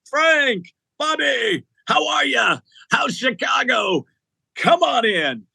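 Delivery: put-on big warm hello to old pals. [name] greets father and son.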